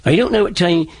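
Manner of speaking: broad East Anglian settler accent; old East Anglian accent. Shown as a demonstration. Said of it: "they sounded like this".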